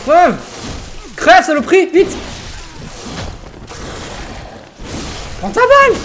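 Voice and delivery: yelling curses at the enemy; angry shouting. Real, not acted. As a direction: shout each hit in fury. Hitting the boss, shouting insults.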